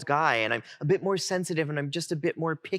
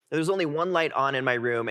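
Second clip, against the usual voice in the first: usual speaking voice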